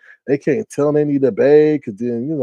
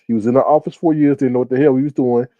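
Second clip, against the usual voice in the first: ordinary talk, nothing marked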